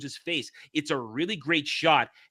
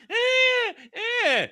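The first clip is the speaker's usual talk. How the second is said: high mock screaming "eh, eh". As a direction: squeal like the screaming woman. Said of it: "She's going".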